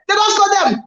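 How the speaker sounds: high, rising disbelief; mock excited call. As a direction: sell the shock of the goal. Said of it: "Do am in the pidgin lifestyle".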